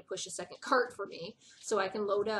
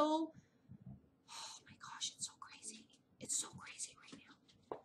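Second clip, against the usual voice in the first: breathless whispered aside, slow at first; 7 semitones higher, 8 dB quieter, slower than usual. Real, not acted. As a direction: hush it like a secret, too wound up to say it aloud. Giddy aside about a crazy morning.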